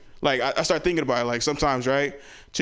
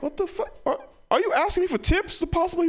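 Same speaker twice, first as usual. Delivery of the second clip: much higher, squeaky mock disbelief; shocked disbelief. Comedian to cousin going vegan, high incredulous squeal. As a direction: act flabbergasted by the question.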